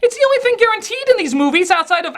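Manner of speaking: shrieky high, hurried protest; exasperated rant. Acted. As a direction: cut in with a high, fed-up outburst. Cuts off clip with exasperated rant.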